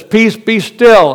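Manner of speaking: loud, high, slow command. Acted. Jesus commanding the wind and waves, loud and slow. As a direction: proclaim it slowly to calm the wind.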